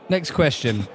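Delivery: ordinary delivery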